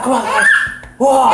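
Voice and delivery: high, loud laughing shout. Real, not acted. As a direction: burst out laughing and yelling. Reacting to the handstand.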